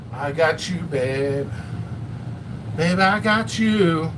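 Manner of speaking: a lightly voiced, slow lazy croon; Bill Murray's alarm song. Mock Groundhog Day alarm, a lightly sung croon. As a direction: drawl it like the radio waking Bill Murray. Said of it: "It's like Bill Murray waking up".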